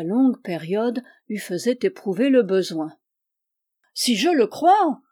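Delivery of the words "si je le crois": raised, louder burst of fervour; fervent. A narrator's reading. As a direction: answer with eager, swept-up conviction. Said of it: "reprit-il avec entraînement".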